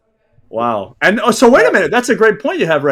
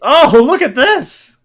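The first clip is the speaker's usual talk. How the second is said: excited; 9 semitones higher, 4 dB louder, slower than usual. Real, not acted. Reacting to the slide.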